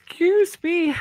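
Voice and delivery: talking through a yawn. Real, not acted. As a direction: speak mid-yawn, then excuse it. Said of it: "I just yawned".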